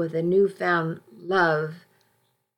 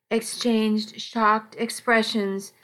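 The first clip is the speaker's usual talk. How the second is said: usual speaking voice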